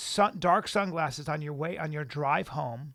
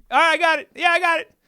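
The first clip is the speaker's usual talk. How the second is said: much higher, louder, jittery assurance; wired actor agreeing; 11 semitones higher, 11 dB louder, faster than usual. Retold or imitated. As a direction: rattle it off too high on coke. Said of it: "He goes".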